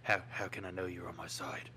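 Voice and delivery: put-on low, suspicious Scottish accent; a wary Scottish thug sizing up a friend; Scottish villain accent. [name] playing a shady Scottish dealer to his friends. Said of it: "I've never heard you do this accent, [name]".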